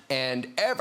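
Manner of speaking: ordinary delivery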